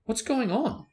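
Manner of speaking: high, startled cry; sudden fright in the dark; alarmed. A narrator's reading. Ron startled as lights go out, alarmed. Lamps go out in darkness.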